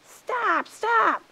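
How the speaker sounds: high, loud plea to stop; crying out. A narrator's reading. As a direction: cry out to halt the quarrel. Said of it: "cried Coyote".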